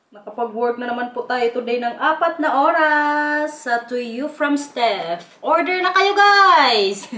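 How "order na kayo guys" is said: higher-pitched, upbeat call to order; calling out a plug. Real, not acted. Vlogger urging viewers to order from the shop, calling out.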